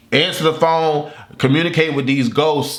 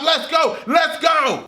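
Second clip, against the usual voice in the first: cheering scream; 10 semitones higher, slower than usual. Retold or imitated. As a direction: hype it up like the home team just scored. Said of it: "I'm screaming and stuff".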